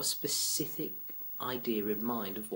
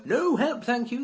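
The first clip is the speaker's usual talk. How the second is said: much higher and louder no help thank you; loud refusal; 13 semitones higher, 10 dB louder, slower than usual. Pretend. Customer refusing the shop assistant's help, loud. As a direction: bark the refusal like a lunatic. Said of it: "seem like a lunatic".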